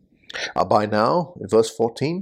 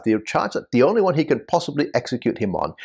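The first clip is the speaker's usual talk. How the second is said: ordinary talk, nothing marked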